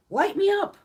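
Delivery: eager. Real, not acted. Daring the doctors to give her every shot, gleeful. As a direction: fire it off like a dare. Eager about getting booster shots.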